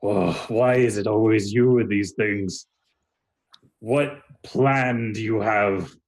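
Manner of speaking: slow, drawn-out weary sigh of a voice; weary exasperation. Acted. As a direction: drag it out, fed up with him. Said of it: "he narrows his eyes".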